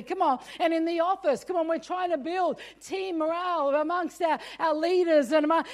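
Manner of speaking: ordinary delivery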